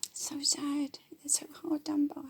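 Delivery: high, soft sarcastic whine; mock whining. Mocking the whining rich, a high sarcastic whine. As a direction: mimic the rich moaning with fake pity. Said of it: "weaning and whining and going".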